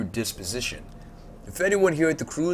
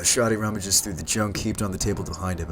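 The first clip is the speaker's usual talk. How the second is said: ordinary delivery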